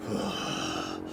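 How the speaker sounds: long tired exhale; weary sigh. Real, not acted. Relating to the sleepless kid, a weary sigh. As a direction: breathe out the exhaustion.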